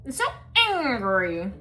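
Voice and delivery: put-on stretched-out, lightly pouty child voice; mock angry child. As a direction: drag it out like a sulking kid.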